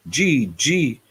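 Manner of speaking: ordinary delivery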